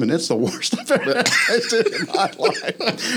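high, laughing through the words. Real, not acted. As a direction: crack up while telling it. Telling funny story, cracking up.

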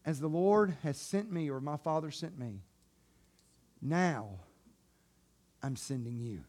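hushed tender Jesus; soft reverent telling. Acted. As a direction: tell it gently, full of awe.

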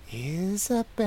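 eerie high mocking call; high-pitched mocking. A narrator's reading. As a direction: taunt from the dark in a thin high voice. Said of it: "a high-pitched voice mocked from the darkness".

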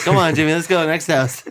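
high, loud hurrying parent; pushy parent. Acted. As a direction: herd the kid on to the next house. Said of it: "parent that's standing right behind you".

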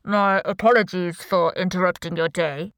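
disguised voice, mock courtesy; anonymous boss on a video call; deep, distorted voice. A narrator's reading. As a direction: speak politely through a voice changer. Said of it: "in a deep, distorted voice".

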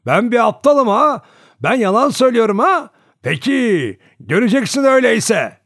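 hoarse shouting. A narrator's reading. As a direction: roar the threat across the yard. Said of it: "boğuk bir sesle bağırıyordu".

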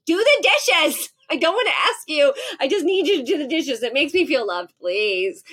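high, loud urgent plea; pleading demand. Retold or imitated. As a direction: beg him to do the dishes, half joking. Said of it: "And I'm like".

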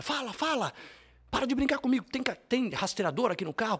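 high, pushy mock robber pressing him; imitating the robber. Robber threatens him in car.

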